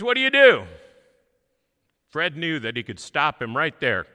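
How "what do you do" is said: loud, high, hearty question; loud booming man. Retold or imitated. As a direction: boom the question across the room. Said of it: "he speaks very loudly".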